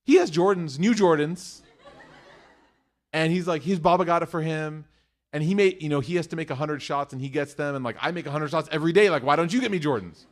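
put-on upset kid complaint. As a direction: plead like a jealous seven-year-old. Son's upset complaint acted.

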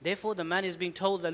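ordinary delivery

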